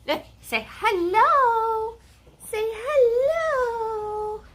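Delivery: very high, very slow, drawn-out pet voice. Real, not acted. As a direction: stretch hello out sweetly for the pup. Coaxing the dog to greet.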